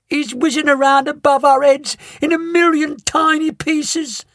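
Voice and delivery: high, panicked mother's voice; a desperate high wail; frantic. A narrator's reading. Mother panics to Mister Wonka, high and frantic. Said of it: "screamed Missus T V".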